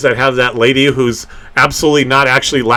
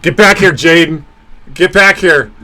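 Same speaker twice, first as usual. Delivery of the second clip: raised pitch, calling after someone; calling someone back; 4 semitones higher than usual. Real, not acted. Host calls after Jaden who ran away, calling out. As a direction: call out to bring them back.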